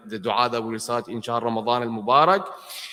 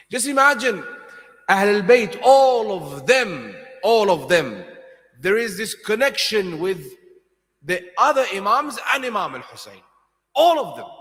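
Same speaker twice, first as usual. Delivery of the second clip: higher and louder sermon climax; impassioned preaching; 7 semitones higher, 6 dB louder than usual. Acted. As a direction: preach it with rising fervour. Preaching at full force.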